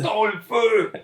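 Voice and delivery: high booming villain battle cry; Bernard Fortin villain voice. Retold or imitated. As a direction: shout it like a cartoon villain dub. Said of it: "pour entendre la voix de Bernard Fortin qui dit".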